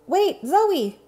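high, loud call; Elmo calling after. Acted. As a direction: call out after a friend who is leaving. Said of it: "Elmo called after her".

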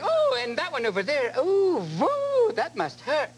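hammed-up shocked shopper; mock amazement. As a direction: wince at the sight of it. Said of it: "And people going".

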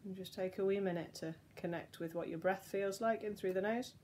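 soft, unhurried relaxation voice with long pauses; guided breathing. Real, not acted. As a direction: softly lead them through each breath. Leads slow breathing exercise.

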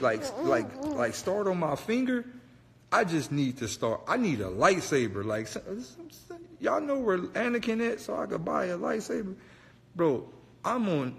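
ordinary talk

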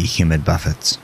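usual speaking voice

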